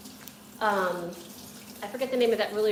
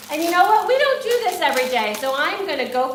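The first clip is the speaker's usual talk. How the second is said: higher and louder, thrilled; excited; 5 semitones higher, 8 dB louder than usual. Real, not acted. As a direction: burst out with delight. Excited decision to go big.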